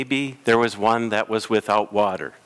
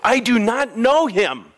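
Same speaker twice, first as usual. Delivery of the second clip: raised, higher pleading denial; Peter's panicked denial; 11 semitones higher than usual. Retold or imitated. Preacher voicing Peter denying Jesus, raised and pressing. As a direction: Peter insisting he doesn't know Jesus.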